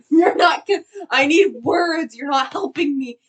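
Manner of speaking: high, loud retold outburst; frantic protest. Passenger begging the driver for words, retold frantic yelling. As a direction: act out shouting in exasperated panic.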